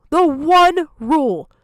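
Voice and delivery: The one rule, slow and loud, each word hammered; slow furious emphasis. Real, not acted. Streamer repeating the broken rule, slow and furious. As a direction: spell out "The one rule" slowly with mounting anger.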